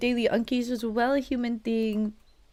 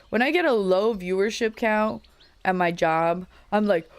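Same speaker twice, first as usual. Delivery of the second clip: ordinary delivery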